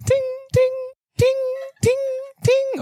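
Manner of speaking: high pinging ting sounds; ping like bullets bouncing off; high mock ricochet pings; bullet ricochet noise.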